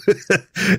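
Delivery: short high cackle; high mocking laugh. A narrator's reading. Melvin laughs at Gavin mid-retort, high cackle. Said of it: "Melvin laughed".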